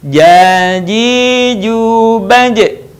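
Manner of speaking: "ja, ji, ju, baj" joined in a long-vowelled chant; swung syllables. Shown as a demonstration. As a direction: swing smoothly through "ja, ji, ju, baj". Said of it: "gabungkan dengan ayu-ayun". ("ja, ji, ju, baj" diayun bersambung)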